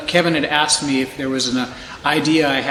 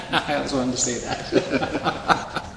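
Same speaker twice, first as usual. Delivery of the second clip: laughing; 2 semitones lower, 5 dB quieter, slower than usual. Real, not acted. Laughing after the call.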